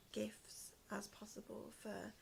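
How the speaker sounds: normal talking voice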